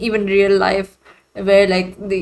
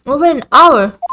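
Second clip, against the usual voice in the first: higher, louder surprised question; startled echo; 5 semitones higher, 6 dB louder than usual. Acted. Questioning how long the wait has been, startled. Echoes a line in disbelief.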